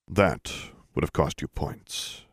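put-on dry old sage remark; deep resonant voice. A narrator's reading. Old wizard speaks again.

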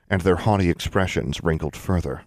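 ordinary delivery, nothing marked

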